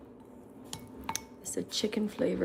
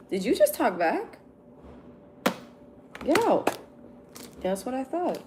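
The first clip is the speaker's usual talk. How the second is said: high, loud mock-offended scold; mock outrage; 7 semitones higher, 5 dB louder than usual. Streamer scolds a cheeky viewer with mock outrage. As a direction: play the outraged mom, over the top.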